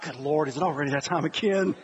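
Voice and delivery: low, softened mock dread; weary wife. Wife facing another football season, low weary resignation.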